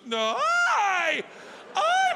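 squeaking very high and loud; a frightened old lady caught in the light; startled old woman. Retold or imitated. Old actress surprised in the closet, very high frightened squeak.

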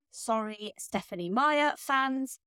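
usual speaking voice

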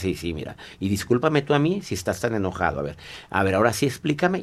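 soft, conciliatory murmur; hushed, low-pitched apology. Acted. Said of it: "sigue manteniendo la voz baja".

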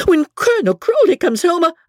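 high, indignant threat; acted furious mistress, shrilly high; in a fury. A narrator's reading. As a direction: warn the servants, pitch jumping up. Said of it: "cried Becky in a fury".

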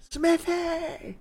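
high-pitched singsong hail; calling out. Acted. Player hailing a hard-of-hearing smith, high call. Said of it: "he's the one hard of hearing".

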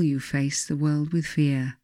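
normal talking voice

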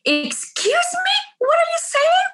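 mock-indignant high squeal at a stranger; shocked outrage. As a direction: shoot way up in pitch, scandalised. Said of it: "And I was like".

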